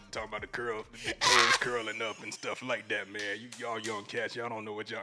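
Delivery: put-on low old-timer voice; old school men's voice. Old-school man lecturing young cats, put-on voice. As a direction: talk down low like an old head. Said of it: "I don't know where that voice came from".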